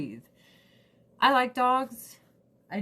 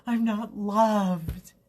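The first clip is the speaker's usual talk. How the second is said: voicing the dog; 2 semitones higher, 3 dB louder than usual. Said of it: "she's just like".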